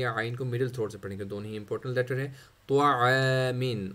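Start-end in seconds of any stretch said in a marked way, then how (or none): 2.7-3.9 slow त्वामिन with a heavy त्वा and ain from the throat. Shown as a demonstration. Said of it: "त्वा को मोटा पढ़ेंगे".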